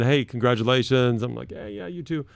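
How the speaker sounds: ordinary delivery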